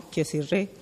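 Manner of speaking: ordinary delivery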